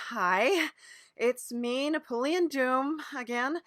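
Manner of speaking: ordinary delivery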